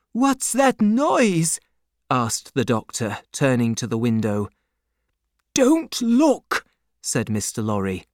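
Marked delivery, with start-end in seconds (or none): 0.1-1.6 high, slow, puzzled question; alarmed question. A narrator's reading. Doctor turns to the window, asking in alarm. Doctor hears noise outside. 5.5-6.7 high, urgent command; urgent warning. A narrator's reading. Banker keeps doctor from window, warning urgently.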